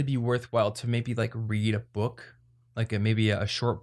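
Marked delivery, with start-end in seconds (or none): none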